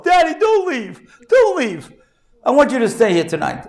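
high-pitched mock child's plea; pleading little boy. Boy at the door begging father, imitated high tearful plea. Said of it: "the little boy cries himself to sleep".

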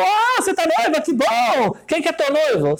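high, slow, beaming cabbie; taxi driver voice. Retold or imitated. Taxi driver cheerfully greeting his bride passenger, high voice.

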